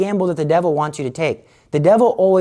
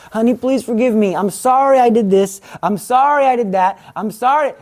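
pleading husband; 6 semitones higher, 3 dB louder than usual. Acted. Husband begs forgiveness.

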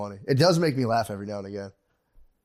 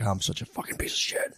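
hushed whisper, ashamed; muttering to himself, whispered and ashamed; whispered self-reproach; 4 semitones lower, 8 dB quieter than usual. Retold or imitated. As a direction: whisper it to yourself like a guilty confession. Inner voice of self-blame.

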